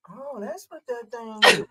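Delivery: mom finally gets it, amazed; imitating mom. Son imitating his mother discovering the camera button.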